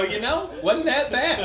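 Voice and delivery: mock fan reply. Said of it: "tweet at us and go".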